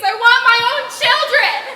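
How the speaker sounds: loud, pleading outburst; passionate cry. Acted. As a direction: cry it out to Mama. Bella pleading to mother.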